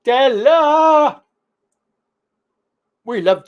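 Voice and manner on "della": calling out. Real, not acted. Calling out to the girlfriend.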